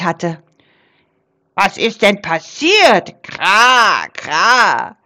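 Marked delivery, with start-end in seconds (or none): 1.5-5.0 raven asking. Acted. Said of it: "fragte der Rabe Krax".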